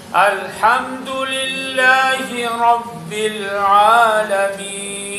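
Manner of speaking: very slow, sustained chant; Quran recitation. Shown as a demonstration. Teacher models the verse for repetition, very slow chant. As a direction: stretch the long vowels for pupils to echo.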